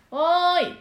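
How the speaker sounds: loud, high call across a distance. Shown as a demonstration. Voice teacher demonstrating a firm closed voice, calling out loud. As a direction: throw the voice out, full and ringing. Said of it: "こういう声なんですけど".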